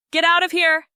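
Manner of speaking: loud urgent yell. A narrator's reading. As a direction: yell at her to flee. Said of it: "yelling at Meng Meng".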